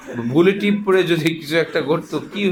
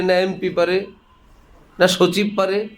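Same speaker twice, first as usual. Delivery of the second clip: usual speaking voice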